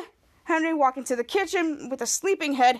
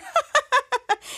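high-pitched mock cackle; imitated laughing; 10 semitones higher than usual. Piper laughing at Henry, imitated laughing. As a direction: cackle like a pleased little prankster. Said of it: "somebody was laughing".

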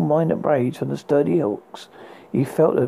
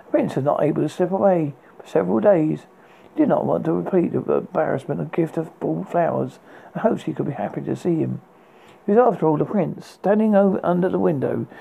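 ordinary delivery, nothing marked

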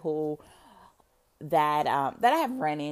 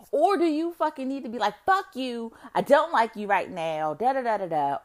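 a hammed-up snap at a partner, a touch louder and higher; mock angry outburst; 7 semitones higher, 4 dB louder than usual. Imitating a partner's outburst, hammed-up angry yell. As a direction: act out telling your partner off.

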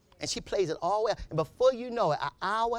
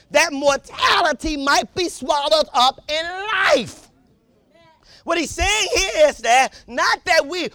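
loud, high preaching of the text; fiery sermon delivery; full-force preaching; 9 semitones higher, 9 dB louder than usual. Acted.

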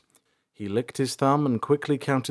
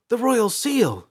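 high, loud burst of recognition; excited exclamation; 14 semitones higher, 5 dB louder, slower than usual. A narrator's reading. Princess recognises her father's seal, exclaiming. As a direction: exclaim it, voice leaping up. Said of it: "she exclaimed".

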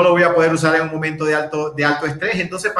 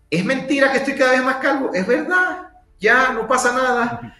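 much higher, lightly played comeback; high-pitched acted reply; 7 semitones higher than usual. Man answers a remark on his baldness, high-pitched acted reply. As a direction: pitch up a mock self-reply. Acts reply to being called bald.

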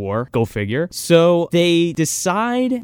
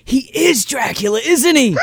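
excited; 8 semitones higher than usual. Real, not acted. Excited realization about actor.

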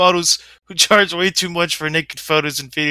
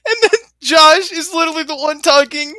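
laughing; 10 semitones higher than usual. Real, not acted. Laughing at friend.

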